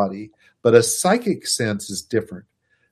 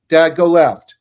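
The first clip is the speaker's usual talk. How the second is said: high, loud warning from the back seat; a kid yelling at dad to turn left; urgent child's call; 6 semitones higher, 11 dB louder, slower than usual. Retold or imitated. Child urging dad in car.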